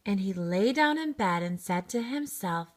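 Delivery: usual speaking voice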